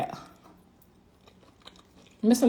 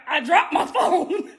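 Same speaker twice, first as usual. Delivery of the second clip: slow, laughing fake innocence; innocent excuse; 6 semitones higher, slower than usual. Wife fibbing to husband about the noise, slow and laughing. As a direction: cover it up with a sly laugh. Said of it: "You gotta be like".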